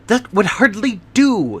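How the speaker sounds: a quick startled outcry; cried out. Acted. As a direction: exclaim it in alarm. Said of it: "he cried".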